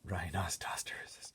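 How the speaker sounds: a slow amused whisper; slow whispered aside. Real, not acted. Reader marvelling at the word, slow whisper.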